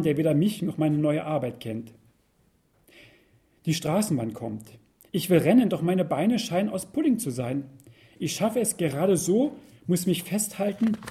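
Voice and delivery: plain reading voice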